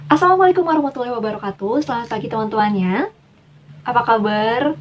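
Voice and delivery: bright, energetic greeting with lively rise and fall; powerful smiling opening. Shown as a demonstration. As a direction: open the presentation smiling and confident. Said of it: "itu lebih kelihatan ya bedanya".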